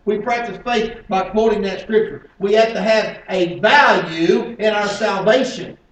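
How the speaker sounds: loud, high-pitched pulpit delivery; full-force preaching. Acted. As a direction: hammer the point home to the congregation.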